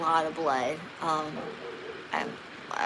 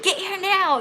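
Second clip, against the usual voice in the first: raised, loud imitated order; mean demand; 12 semitones higher, 5 dB louder than usual. Husband ordering her over during labour, mean. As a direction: imitate an angry, impatient command. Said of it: "being really mean to her".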